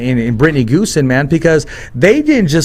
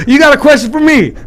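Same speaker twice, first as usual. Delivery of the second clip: much higher, punchy mimicked comeback; Brad; 10 semitones higher, 4 dB louder than usual. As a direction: imitate a buddy's insistent back-and-forth routine. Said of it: "He goes".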